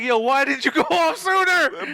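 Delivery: high, laughing whine at the grenade; laughing mock complaint. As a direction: complain while cracking up. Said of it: "my mans was like".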